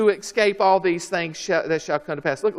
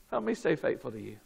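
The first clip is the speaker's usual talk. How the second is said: very soft, lowered, quick plea; quiet prayer; 3 semitones lower, 10 dB quieter, much faster than usual. Acted. Preacher prays to God as government gets tough, hushed. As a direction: pray it under the preaching. Prayer answering each raised line.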